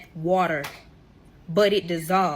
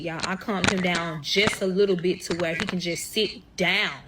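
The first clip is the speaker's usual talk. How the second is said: normal talking voice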